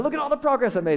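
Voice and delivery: high, bragging self-congratulation; proud. Retold or imitated. Mocking a believer proud of their progress. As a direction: boast about your own progress. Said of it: "You're like".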